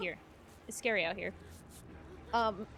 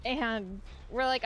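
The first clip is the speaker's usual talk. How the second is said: normal talking voice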